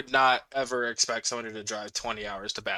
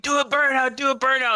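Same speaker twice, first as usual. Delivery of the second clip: high, excited call to the cops; egging them on from the curb; cheering shout; 11 semitones higher, 4 dB louder than usual. Retold or imitated.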